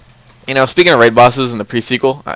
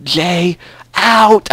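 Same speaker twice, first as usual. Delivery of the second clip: lightly imitated sign-off, drawn out and higher; Gage; 6 semitones higher than usual. Gamer quoting an echo log sign-off, light imitation. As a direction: mimic a recorded log's sign-off, lifting the last word. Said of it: "pull that Gage Echo Log reference".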